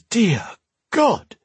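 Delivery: put-on high, startled old man's gasp; stunned old man, voice leaping up; gasped shock. A narrator's reading. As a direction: gasp it out in high-pitched astonishment. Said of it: "the old man gasped".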